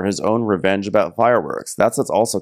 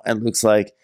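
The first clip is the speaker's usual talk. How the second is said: usual speaking voice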